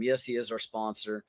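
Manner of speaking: ordinary talk, nothing marked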